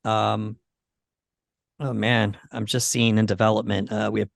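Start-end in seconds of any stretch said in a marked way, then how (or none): none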